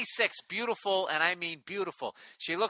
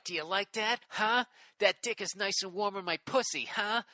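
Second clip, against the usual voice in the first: lightly put-on English accent, raised pitch; mock English accent; 5 semitones higher than usual. Man reading his date's dirty talk, mock English accent. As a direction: play the divorcee in a teasing English accent. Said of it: "she's saying it with the English accent".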